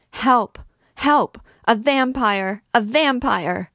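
high, piercing scream for help; shriek. A narrator's reading. Esmeralda's last effort against the priest, shrieking. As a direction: shriek with the last of her strength. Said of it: "She made one last effort and shrieked".

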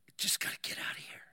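fast, soft, voiceless urgency; panicked whisper. Retold or imitated. Claustrophobic man muttering to himself, fast panicked whisper. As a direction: whisper it quickly, desperate to escape.

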